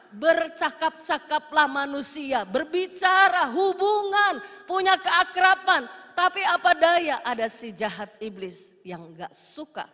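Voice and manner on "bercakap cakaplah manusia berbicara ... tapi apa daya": loud, high, slow drawn-out proclamation; emphatic preaching. Real, not acted. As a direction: build to a ringing sermon peak, stretching each phrase. Preacher builds emphatically on human closeness. (berkhotbah lantang dengan penuh penekanan)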